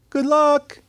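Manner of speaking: high calling-out from far behind; distant cheering-on. Acted. Unhelpful onlooker calling to someone in a dark hallway, high distant call. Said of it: "the other voice you may hear".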